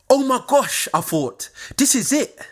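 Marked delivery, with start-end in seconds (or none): 0.1-0.9 excited gasp. Retold or imitated. Unknown number rings, hoping uni. 1.8-2.4 thrilled. Retold or imitated. Hoping the call is a uni.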